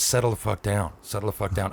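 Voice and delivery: dropped low and soft, steady order; low firm calming. Retold or imitated. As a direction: hush him down, firm and quiet. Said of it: "He goes".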